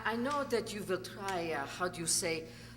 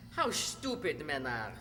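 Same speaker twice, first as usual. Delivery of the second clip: contemptuous; 5 semitones lower than usual. Acted. Wife's contempt for men.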